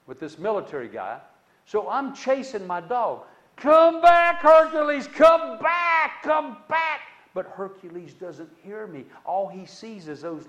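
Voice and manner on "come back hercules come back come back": loud high yell after the dog; yelling a runaway dog's name; calling out loud. Retold or imitated. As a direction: call the dog back across the yard, shouting.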